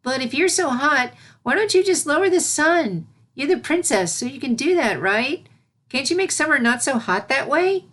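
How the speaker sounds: high, chatty little voice full of questions; an inquisitive kid puzzling it out; curious filly. Acted. Voicing the young filly.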